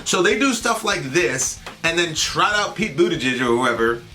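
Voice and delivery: ordinary talk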